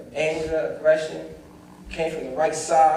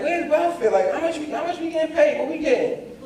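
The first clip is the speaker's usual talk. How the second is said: mildly incredulous, high-pitched questions; baffled questioning; 9 semitones higher than usual. Retold or imitated. Son wondering about the welfare money, baffled. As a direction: puzzle it out in a higher voice.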